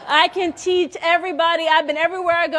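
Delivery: high, loud mock bragging; gleeful, over-eager show-off; playful boasting. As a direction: crow about it with a laugh. Boasts playfully of new walking skill.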